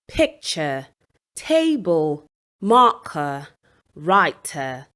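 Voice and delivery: "picture", "table", "marker", "writer", each stressed on the first syllable. Shown as a demonstration. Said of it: "the stress on the first syllable".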